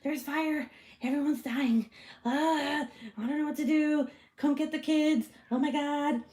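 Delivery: high, panicked wail, re-enacted; shaking voice. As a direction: act out a frantic phone call for help. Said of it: "My voice is shaking".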